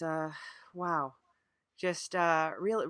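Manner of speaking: ordinary delivery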